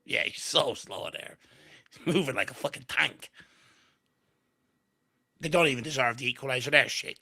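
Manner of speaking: ordinary talk, nothing marked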